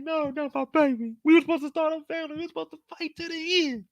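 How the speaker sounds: high, fast distraught wailing; grieving Yin. Retold or imitated. Yin crying over Frankie jumping, high distraught wail. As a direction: lament your partner leaving. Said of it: "Yin is looking like".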